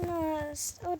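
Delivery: normal talking voice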